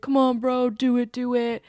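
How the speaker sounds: imitating rowdy bros cheering a friend on; mock peer-pressure chant; egging on. Buddies push groom to go upstairs, egging him on.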